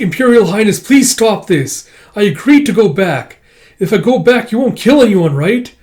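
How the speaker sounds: high, loud begging; acted frantic appeal; desperate pleading. Princess begs for mercy.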